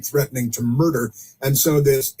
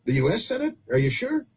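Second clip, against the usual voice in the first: incredulous; 10 semitones higher than usual. Pretend. Mock disbelief at Senate claim.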